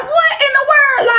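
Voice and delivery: high, shouted disbelief; shocked shout. Retold or imitated. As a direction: cry out in shock. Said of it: "I was like".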